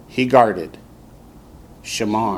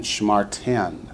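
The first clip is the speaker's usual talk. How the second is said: ordinary talk, nothing marked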